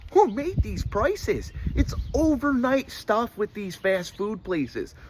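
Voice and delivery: normal talking voice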